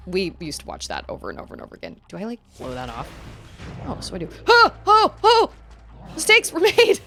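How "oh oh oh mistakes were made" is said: high, loud panicked yelp; loud startled cry; alarmed outburst. Real, not acted. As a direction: realize the blunder with a cry. Hit by enemy in game.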